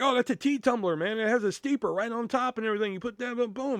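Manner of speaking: high-pitched, hurried product demo; upbeat sales pitch. Retold or imitated. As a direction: talk up the product to a shopper. Re-enacting tea tumbler pitch to customer.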